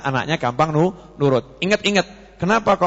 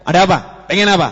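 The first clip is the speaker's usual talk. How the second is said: put-on louder, snapping at the child; curt parent; 5 dB louder, much faster than usual. Impatient parent snapping at child, mock harsh. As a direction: bark the question impatiently. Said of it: "orang tuanya".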